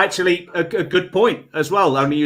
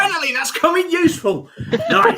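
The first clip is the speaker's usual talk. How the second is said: laughing; 13 semitones higher than usual. Real, not acted. Pleased reaction in game.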